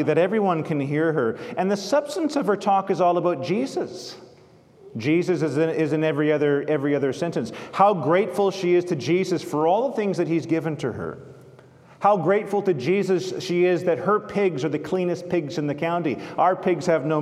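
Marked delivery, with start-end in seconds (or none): none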